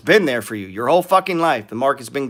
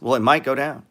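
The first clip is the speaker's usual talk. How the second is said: ordinary talk, nothing marked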